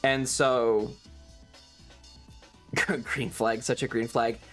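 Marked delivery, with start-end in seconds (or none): none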